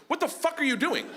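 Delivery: high-pitched alarmed trainer; incredulous scolding. Retold or imitated. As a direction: snap at a clueless amateur in disbelief.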